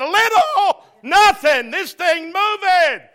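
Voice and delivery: loud, laughing mock protest from the passengers; incredulous group outcry. Passengers shouting back at the captain, laughing disbelief. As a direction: cry out in disbelief at the bumpy ride. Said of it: "every one of us in unison said".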